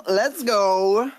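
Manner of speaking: ordinary talk, nothing marked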